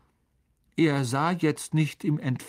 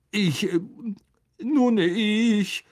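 higher, halting stammer; 10 semitones higher, 4 dB louder, much slower than usual. A narrator's reading. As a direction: trip over each word. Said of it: "stammelte Lockhart".

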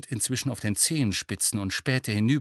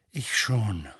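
subdued, mildly downcast; dejected. A narrator's reading. Harry confessing that he already knew, downcast. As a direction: admit it with a touch of heaviness. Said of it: "sagte er bedrückt".

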